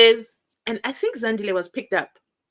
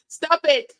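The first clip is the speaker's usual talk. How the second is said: stern stop it; 6 semitones higher, 4 dB louder than usual. Retold or imitated. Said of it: "literally told the brigadier".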